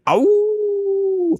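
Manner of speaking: imitated dog howl. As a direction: howl like the barking dogs. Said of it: "They're like going".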